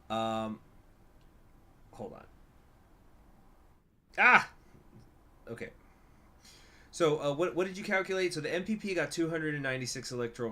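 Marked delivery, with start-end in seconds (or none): 4.2-4.5 short, loud, high "ah!"; sudden yelp. Real, not acted. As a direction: yelp out abruptly. Sudden cry while working out numbers.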